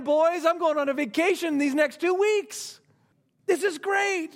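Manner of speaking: high-pitched, acted jackpot excitement; giddy anticipation. Worker to the boys about the pay, giddy glee. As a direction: crow as if you just struck gold. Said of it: "they start doing a little calculating".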